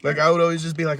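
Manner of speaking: ordinary talk, nothing marked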